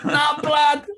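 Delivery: British street tough. Retold or imitated. Mocking British road rage, London thug shouting threats. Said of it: "you miss the old, uh, the British".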